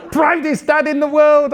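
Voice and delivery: high, loud triumphant shout; proud shout. Acted. Dad bragging about his son, proud shout. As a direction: crow with pride.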